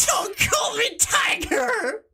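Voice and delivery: high, slow, wailing outburst; furious shout. Acted. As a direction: scream it like a tantrum. Teacher furious at nickname.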